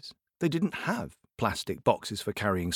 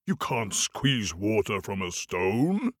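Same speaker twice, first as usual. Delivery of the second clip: giant voice; 2 semitones lower, slower than usual. Acted. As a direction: rumble it out like a giant. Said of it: "giant voice".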